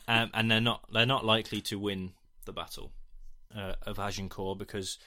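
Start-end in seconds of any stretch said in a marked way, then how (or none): none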